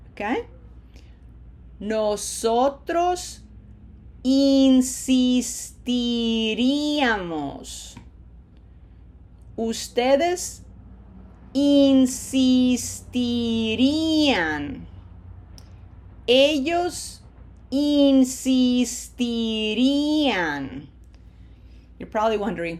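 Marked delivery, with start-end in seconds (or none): 1.8-14.9 "insistiríamos" very slow, every i held as "ee"; smiled long vowels. Shown as a demonstration. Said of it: "I have to smile for all four of these". 16.3-20.9 very slow "Ellos insistirían", every vowel held long; slow pronunciation model. Shown as a demonstration. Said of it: "what does it sound like at normal speed".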